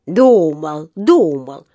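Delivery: loud, mocking echo; не унимаясь. A narrator's reading. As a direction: taunt by repeating the word. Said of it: "не унимался вахтёр". (громко передразнивая)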